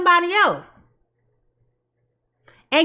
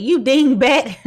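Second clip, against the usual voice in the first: laughing repeat; 4 dB louder, slower than usual. Acted. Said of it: "I'm laughing 'cause I said".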